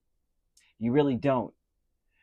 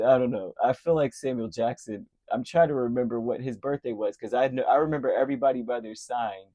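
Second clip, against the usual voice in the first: ordinary delivery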